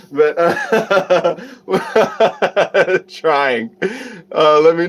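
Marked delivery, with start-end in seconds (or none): 1.7-3.0 real embarrassed laugh. Real, not acted. Streamer unsure of the British accent attempt, self-conscious laugh. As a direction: laugh at your own attempt.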